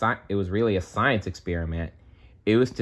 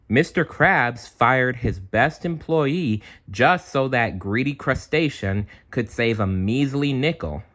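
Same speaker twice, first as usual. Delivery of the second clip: plain reading voice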